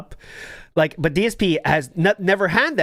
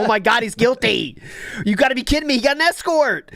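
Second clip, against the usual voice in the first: higher, mock-shocked outburst; playing the shocked viewer; 7 semitones higher than usual. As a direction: act out a stunned listener. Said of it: "it quite literally sounds like he did have the escort".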